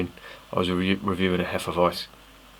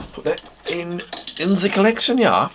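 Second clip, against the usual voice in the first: high-pitched hammed-up German accent, louder mid-phrase; mock German accent; 11 semitones higher, 4 dB louder than usual. Beer reviewer slipping into a joking German accent. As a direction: play a jokey stiff German while filing the cap. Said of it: "I start talking like the Germans".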